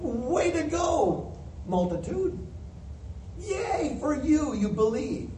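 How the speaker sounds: drawn-out, high-pitched mock cheer; slow, singled-out pretend praise; mock applause. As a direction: stretch it out like hammed-up congratulations. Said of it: "give them some applause".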